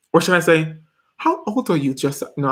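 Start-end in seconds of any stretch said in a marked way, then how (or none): 1.2-1.9 higher, tentative British accent; amateur British accent; attempted British accent. Shown as a demonstration. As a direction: have a shaky go at a British accent. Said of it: "I can't do that accent".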